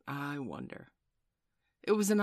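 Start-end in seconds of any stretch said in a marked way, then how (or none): 0.1-0.9 softer, musing, falling away; thoughtful. A narrator's reading. Husband pondering to himself about the nurse, thoughtful. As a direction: let it sink into private thought. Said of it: "Mister Darling said thoughtfully".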